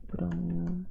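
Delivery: murmuring while writing. Real, not acted. Teacher writing the word out for the class, murmuring.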